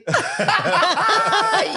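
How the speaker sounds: a loud, high burst of laughter. Real, not acted. Guest cracking up at the catchphrase, loud laugh. Reaction to host's phrase.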